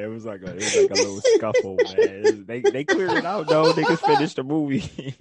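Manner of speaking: loud, high, cracking up mid-story; helpless laughter breaking up the words; laughing hard. Real, not acted. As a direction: tell it through big laughter.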